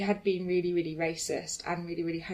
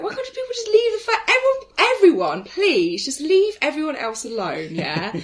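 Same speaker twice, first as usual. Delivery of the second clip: higher and louder, pleading with everyone; exasperated plea; 8 semitones higher, 9 dB louder than usual. Retold or imitated. Telling her friend everyone should leave others alone, exasperated plea. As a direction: beg the world to just back off.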